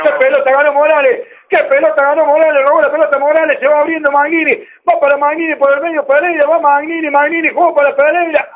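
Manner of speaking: tono agudo sostenido, jugada que se acelera; relato emocionado. Real, not acted. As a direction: relata el ataque con urgencia. Recuperación y ataque en vivo. (narración futbolera entusiasmada)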